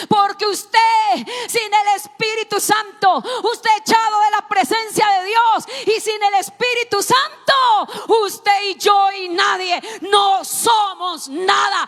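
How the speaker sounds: loud, high shouting sermon; preaching at full force. Acted. Preacher shouting Jesus's cry from the cross, full force. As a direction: cry it out at the top of your voice.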